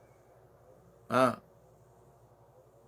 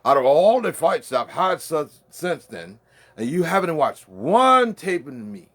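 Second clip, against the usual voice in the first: loud, higher-pitched trash talk at a rival; loud taunt; 4 semitones higher, 8 dB louder than usual. Real, not acted. Taunting a rival fighter who hasn't studied his tapes, loud and higher. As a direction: bark challenges at him, rising up.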